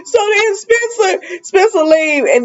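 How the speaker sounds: high voice still full of laughter; talking through laughter. Real, not acted. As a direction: carry on while still cracking up. Recovering from a laughing fit.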